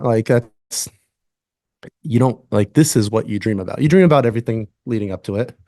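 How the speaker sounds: ordinary talk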